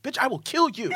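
loud, high-pitched playful threat; hammed-up indignation at a friend, about to crack up; mock outrage.

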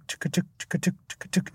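soft, clipped tuk-tuk beat; imitated drum ticking. Shown as a demonstration. Explaining drums as mere timekeeping, soft imitated ticking. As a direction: tick out a quiet background rhythm.

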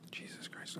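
hushed whisper under breath. Real, not acted. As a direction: whisper so he keeps sleeping. Whispering as Jared sleeps.

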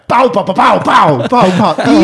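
high, loud mock shots; gunfire finale. Ending the show for the whole restaurant, loud. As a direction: rattle them off in a burst. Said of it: "slutar jag med".

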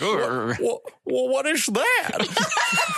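hammed-up, stretched-out star impression; slow, loopy drawl breaking into laughter; Jimmy Stewart. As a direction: stammer into it, slow and high. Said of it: "I'm Jimmy Stewart".

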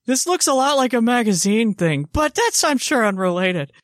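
high, faux-innocent gee-whiz kid voice; a wide-eyed kid, innocently missing the obvious; wholesome 1950s kid. Same voice, faux innocent comment.

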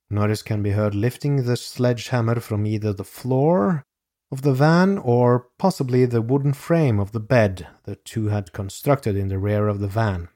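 ordinary delivery

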